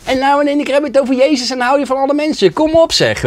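high, loud scoffing imitation of friends; mocking disbelief. Old friends scoffing at his conversion, loud and high.

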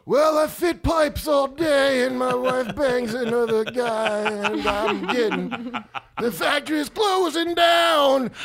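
loud, high, slow shouted lament. Acted. Mocking Buffalo life as a [name] song, a high slow shouted lament. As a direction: belt out a blue-collar hard-luck story like a rock anthem.

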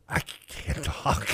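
slow, voiceless croak; strained whisper with the voice gone; lost voice. Acted. Radio host mimicking a Friday with no voice left, voiceless croak. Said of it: "they're like".

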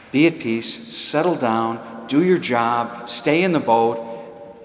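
very slow, every phrase weighed; slow deliberate charge. Real, not acted. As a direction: lay each command down slowly. Slow emphatic instruction to congregation.

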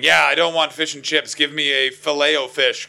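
brash tourist. Acted. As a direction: loudly order like a clueless tourist. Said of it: "tourists going".

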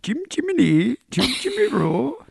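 very high, hammed-up Cockney chirp; badly faked London chimney-sweep accent; squeaky singsong mock-Cockney.

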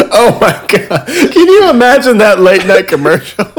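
loud, high, laughing exclamation; laughing excitedly. Real, not acted. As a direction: burst out laughing in delight. Laughing at lawsuit idea.

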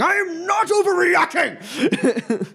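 high, slow, furious bellow breaking into a laugh; furious shout. Acted. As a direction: explode at the dean. Professor's outburst.

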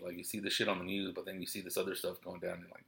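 ordinary talk, nothing marked

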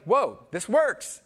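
slow, drawn-out wonder; amazed. Retold or imitated. Pastor recalling new friendships, amazed and slow. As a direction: let the realisation sink in. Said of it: "like, it was like".

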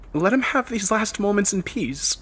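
very high, tearful pleading; acted girl's sob; sobbing plea. A narrator's reading. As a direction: plead for the dying man through tears. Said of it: "Melissa sobbed".

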